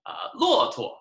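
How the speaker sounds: teacher modelling neutral tone; model word. Shown as a demonstration. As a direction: lift 骆驼 high, let 驼 float. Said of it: "it just kind of floats".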